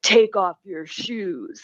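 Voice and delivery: slow, stern mock cop order; imitating the cop. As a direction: lay down the order slowly like a bored officer. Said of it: "He's like".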